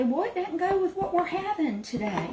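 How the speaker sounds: a hint of grandmother's high-pitched objection; imitated grandmother. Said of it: "And she'd say".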